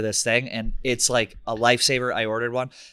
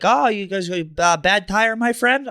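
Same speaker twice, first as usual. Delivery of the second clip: higher, slower voice for the helpful driver; friendly stranger; 8 semitones higher, 4 dB louder, slower than usual. Retold or imitated. Uber driver offers help to a stranded biker, warmly higher. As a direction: lean up into a kindly foreign helper.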